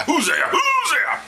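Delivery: high-pitched drawn-out cry of the name; mock-bellowed name, stretched out; exaggerated shout. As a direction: holler it long and high. Clarifying the name, calling it out.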